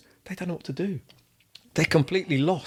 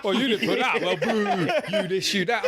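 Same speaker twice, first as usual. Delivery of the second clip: higher-pitched jeer, half laughing; mock heckling; 3 semitones higher, slower than usual. Footballer imitating fans booing him, mock heckling. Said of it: "I was booing at you".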